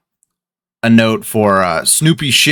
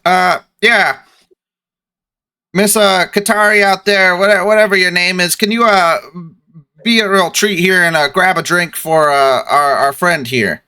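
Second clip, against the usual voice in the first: NPC calling out; 7 semitones higher than usual. Acted. GM voicing a captain calling the server for a drink. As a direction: raise the voice to call a server over.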